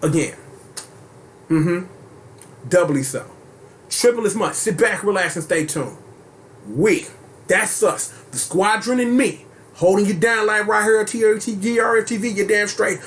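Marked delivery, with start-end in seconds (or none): 3.9-13.0 hyped outro. Acted. Outro hype shout.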